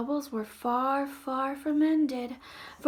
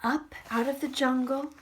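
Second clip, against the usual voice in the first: ordinary delivery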